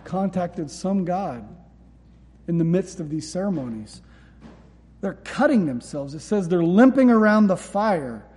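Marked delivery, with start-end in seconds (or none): none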